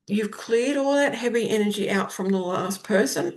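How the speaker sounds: ordinary delivery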